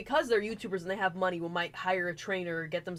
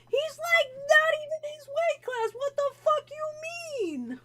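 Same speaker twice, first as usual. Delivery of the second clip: very high, crying mock wail, slow at the end; whiny outrage; 4 dB louder, slower than usual. Friend wailing that Harley is out of his weight class. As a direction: wail it like a betrayed child.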